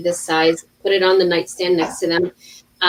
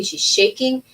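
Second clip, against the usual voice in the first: usual speaking voice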